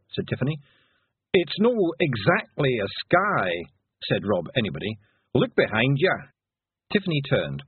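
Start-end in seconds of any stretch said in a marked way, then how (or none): 1.3-6.1 put-on broad Scottish accent.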